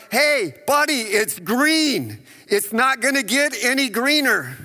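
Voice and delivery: sarcastic jab at the car in front; impatient driver. Retold or imitated. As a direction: needle the guy who won't go. Impatient driver at green light.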